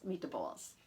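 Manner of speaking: low, soft Italian-accented "meat to balls"; quiet demonstration of an Italian accent. Shown as a demonstration. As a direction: sound out "meat to balls" with its extra Italian vowel. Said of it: "written just like this".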